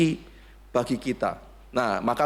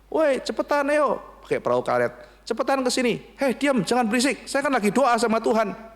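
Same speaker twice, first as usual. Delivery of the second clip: high-pitched urgent calls; hammed-up shooing away; mock shouting; 10 semitones higher than usual. Rescuer shouts, praying man shoos.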